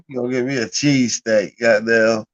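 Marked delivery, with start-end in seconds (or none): none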